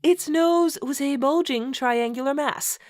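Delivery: mock-grand read-aloud, stifling laughter; story narration. Host reading a bad fanfic to co-host, mock-solemn narration. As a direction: read the purple prose with a straight face.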